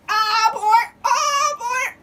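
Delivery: high, loud and eager; excited. Real, not acted. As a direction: egg them on excitedly. Excited cooking, urging.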